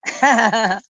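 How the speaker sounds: short amused laugh. Real, not acted. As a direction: chuckle at a co-host's quip. Tertawa menanggapi celetukan lawan bicara. (tertawa geli singkat)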